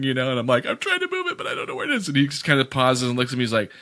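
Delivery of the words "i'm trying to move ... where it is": high, hammed-up fake wail; mock sob pleading with the parking officer; fake crying. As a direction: wail it like a helpless fake cry. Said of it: "fake crying".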